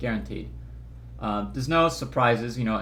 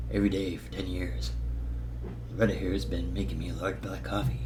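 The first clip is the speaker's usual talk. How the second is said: low, quiet gravel-toned Eastwood voice; Clint Eastwood impression; 6 semitones lower, 5 dB quieter than usual. Vlogger impersonating Eastwood with a low quiet voice. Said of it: "impersonation of Clint Eastwood".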